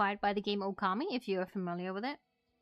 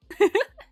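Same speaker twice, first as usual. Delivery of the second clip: high giggle; 11 semitones higher, 12 dB louder than usual. Real, not acted. High laugh.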